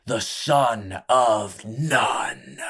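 slow, loud ringmaster shout; announcer shout. Acted. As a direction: bellow it to the crowd.

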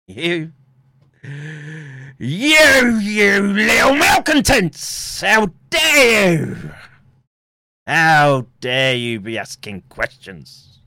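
high, slow ranting tyrant; mock furious shout. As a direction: bellow like an outraged dictator. Mock tyrant scolding the public.

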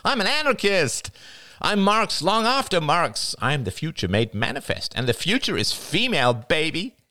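high, showy nasal proclamation; Jada, grandiose scorn. A narrator's reading. Jada scoffing at Diane's jibe, high boastful voice. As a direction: declare yourself grandly, pitched high.

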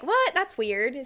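high, loud startled what; mock surprised. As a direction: retell the shock. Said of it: "And I was like".

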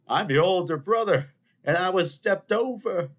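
high, loud aggrieved outburst; Godfather Two impression. Older brother protests being stepped over, indignant movie impression. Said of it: "Godfather Two, anyone?".

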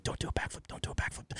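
anxious whispered plea; whispering. Retold or imitated. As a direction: whisper it under your breath, worried. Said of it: "I can hear her ... going".

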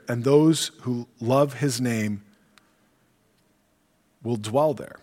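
scripture reading. Acted. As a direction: read the curse gravely. Reads psalm of praise.

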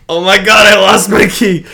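loud, high retold panic; panicked realization. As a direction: cry out on finding the key gone. Retold panic on losing key.

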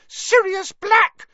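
very high, loud, drawn-out shriek; piercing shriek of shock. Acted. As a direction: scream the name in horrified alarm. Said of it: "she shrieked".

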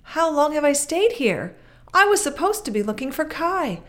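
put-on a startled girl realizing time has slipped away; alarmed cry of a girl searching for her friend. A narrator's reading. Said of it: "she cried".

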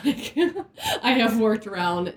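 laughing. Real, not acted. Host laughing about working around naked women. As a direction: laugh through the line.

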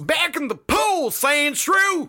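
high-pitched mock holler; country coach yelling. Trainer ordering Sandshrew back in, country coach yell. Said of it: "He was yelling at him".

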